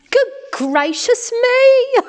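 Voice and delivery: very high, slow, hammed-up shock; drawn-out squeal of dismay; shocked exclamation. A narrator's reading. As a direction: exclaim it in horrified astonishment. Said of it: "looked into the water and exclaimed".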